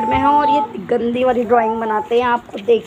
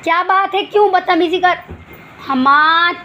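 sharp higher scolding call; 7 semitones higher, 3 dB louder than usual. Real, not acted. Mother scolding her son Hamad mid-video, sharp and raised.

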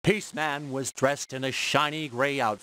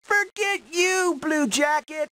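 shouting; 3 dB louder than usual. Acted. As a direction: hurl it back at the rival kid.

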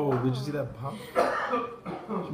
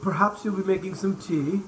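higher, slowing mock English accent; 4 semitones higher, 3 dB louder than usual. Adult proposing tea to the young cook, high pretend English accent. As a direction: suggest it in a put-on English voice. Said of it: "Talking with an English accent".

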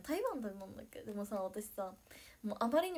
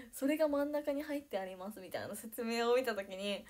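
ordinary talk, nothing marked

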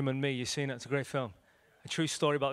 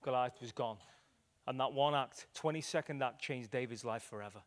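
ordinary talk

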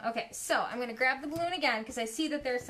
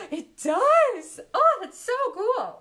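much higher and louder, gasping in wonder; delighted; 8 semitones higher, 8 dB louder, slower than usual. Real, not acted. Painter agrees with a viewer, gasping in delight. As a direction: burst out in delight.

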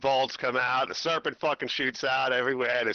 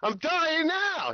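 whiny Adam; 13 semitones higher, slower than usual. Acted. Adam whining to God.

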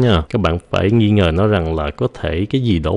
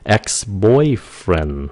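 put-on ex-girlfriend and ex-boyfriend stretched very slowly; slow drill; much slower than usual. Shown as a demonstration. Teacher repeats both words slowly for the class. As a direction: spell them out by sound, slowly. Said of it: "phát âm lại nha".

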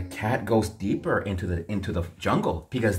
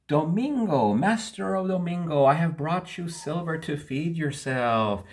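cat voice; 8 semitones higher than usual. Acted. Cat addresses its master.

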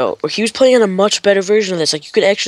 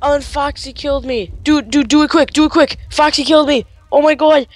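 panicked urging; 4 semitones higher than usual. Real, not acted. Killed player urging friend to do it quick, panicked. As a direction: urge desperately in rising panic.

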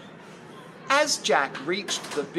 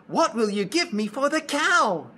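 put-on high, quick boy's voice; young boy; 8 semitones higher, 3 dB louder, faster than usual. Shown as a demonstration. Jack asks what he gets for the cow, high boyish voice. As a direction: play a positive boy, voice pitched up. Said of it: "a little high and like a boy".

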